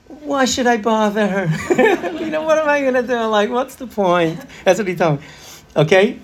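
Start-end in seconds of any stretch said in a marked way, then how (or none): 0.1-4.4 put-on high voice, laughing through the boy's lines; imitating the boy. Teacher voicing a boy who won't tell his mother, laughing. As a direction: play the shrugging kid, half-laughing.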